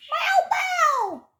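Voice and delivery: loud, very high cat meow. Real, not acted. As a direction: meow high enough to carry through a door. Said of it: "I meow".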